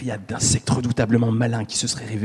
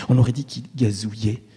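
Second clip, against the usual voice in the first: usual speaking voice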